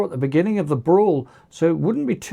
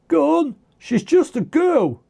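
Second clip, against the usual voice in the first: much higher-pitched goading; egging on; 10 semitones higher than usual. A narrator's reading. One clone to another as they surround Rhea, egging on. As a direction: goad a mate into the fight. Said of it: "they were egging each other on".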